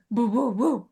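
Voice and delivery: playful dog noises; dog boofing. Retold or imitated. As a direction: imitate the dog's little woofs. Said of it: "then he goes".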